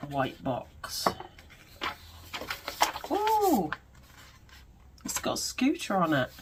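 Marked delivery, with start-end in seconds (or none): 3.1-3.7 high squeal of delight; a sudden high thrilled ooh. Real, not acted. Opens box, sees design.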